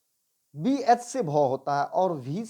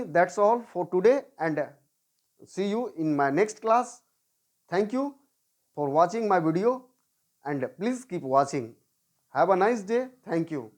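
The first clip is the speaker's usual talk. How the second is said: slow sign-off; much slower than usual. Acted.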